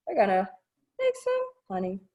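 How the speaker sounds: ordinary delivery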